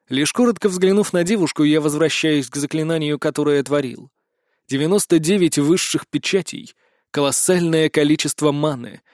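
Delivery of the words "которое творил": ordinary delivery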